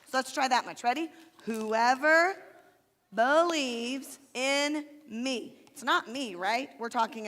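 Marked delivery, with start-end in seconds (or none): 1.4-5.6 put-on each word drawn out slowly for the kids; slow teaching recitation; slow demo. Shown as a demonstration. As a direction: spell the verse out slowly. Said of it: "I'm gonna show you kind of slow".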